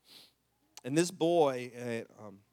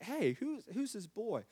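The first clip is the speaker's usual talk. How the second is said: higher, softer voice; retold gentle questioning; 7 semitones higher, 5 dB quieter, slower than usual. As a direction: ask a child, higher and softly.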